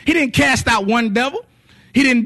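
loud, sung, rhythmic preaching over music; full-force preaching. Acted. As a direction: preach it like a revival climax. Preaching about word and spirit.

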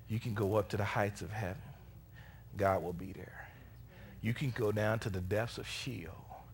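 soft, low scriptural assurance; hushed preaching. Real, not acted. Preacher assuring congregation God will be there, hushed preaching. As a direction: speak low and quietly, leaving room between phrases.

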